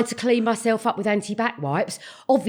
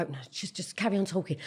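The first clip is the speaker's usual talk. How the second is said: much softer, lower hushed reply; 4 semitones lower, 14 dB quieter than usual. Retold or imitated. Mother quietly tells friends to keep talking. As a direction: keep it down, brush it off. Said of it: "And I'd go".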